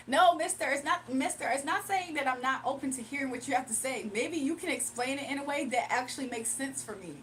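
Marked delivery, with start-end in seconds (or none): none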